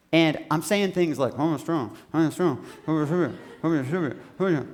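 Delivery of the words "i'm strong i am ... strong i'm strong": mumbled through frozen lips, words smearing together; slurred, low frozen-mouthed chant. Acted. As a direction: mumble it as if your lips are iced shut. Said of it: "upper lip is freezing to the lower lip".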